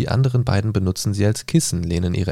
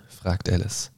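plain reading voice

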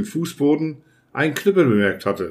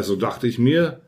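ordinary delivery

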